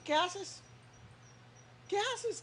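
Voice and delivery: high, insistent questioning; God asking. Acted. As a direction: call the weeping one back. God voiced asking the believer.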